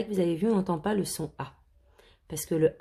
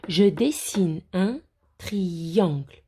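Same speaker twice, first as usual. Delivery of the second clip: "Je dessine un triangle" read slowly; 3 dB louder, much slower than usual. Shown as a demonstration. Teacher reading a sentence slowly for the children. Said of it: "teacher reads a sentence slowly".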